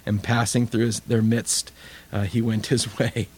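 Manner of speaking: usual speaking voice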